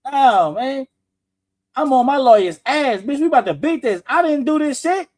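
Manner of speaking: loud high mock indignation; innocent man's protest. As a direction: push it up like a wronged man. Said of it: "Ain't nobody gonna sit back and be like".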